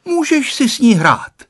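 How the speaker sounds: put-on a small boy eagerly offering; little boy's advice. A narrator's reading. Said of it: "radil jí Honzík".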